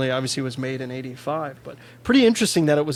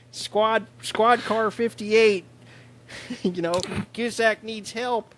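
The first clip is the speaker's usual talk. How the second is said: put-on thick Chicago accent, higher and slow; 7 semitones higher, slower than usual. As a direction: play a Chicago phone operator with a thick Chicago accent. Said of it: "such a thick Chicago accent".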